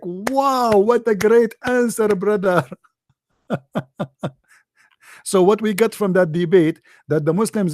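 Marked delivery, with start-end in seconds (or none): 0.0-2.7 high mock amazement, stretched wow. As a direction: gush over the answer sarcastically.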